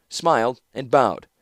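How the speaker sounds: usual speaking voice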